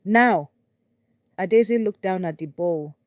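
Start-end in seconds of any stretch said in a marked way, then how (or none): 0.0-0.5 loud, higher harsh order; cold command. Acted. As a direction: snap the order at her. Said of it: "replaced by cold command".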